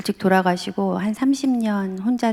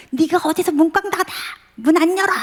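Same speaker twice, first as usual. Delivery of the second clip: angry parent yell; 9 semitones higher than usual. Retold or imitated. Parent yelling at a teen who slammed the door, angry mock shout. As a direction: shout it like a furious parent banging on a shut door.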